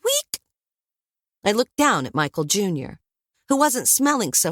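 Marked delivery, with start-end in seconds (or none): 0.0-0.4 very high squeaky week; guinea pig squeak. A narrator's reading. Narrator mimicking the guinea pig's squeak. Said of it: "made a noise that sounded like".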